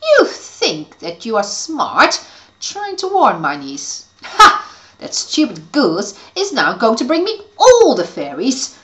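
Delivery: loud, gloating evil witch. Acted.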